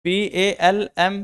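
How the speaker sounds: letter by letter, drawn out; slow spelled-out letters. Shown as a demonstration. Teacher spelling palm slowly for students. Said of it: "एक word बनाना अंग्रेजी का पाम".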